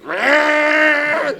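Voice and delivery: loud, high imitated motor roar; leaf blower roar. Leaf blowers drown out the pitch, imitated roaring blare. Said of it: "immediately went".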